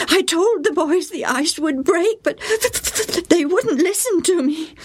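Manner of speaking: put-on high, aggrieved boy's complaint; grumbling boy. A narrator's reading. Joe grumbling to his mother, high and aggrieved. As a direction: sulk about being ignored. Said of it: "Joe grumbled".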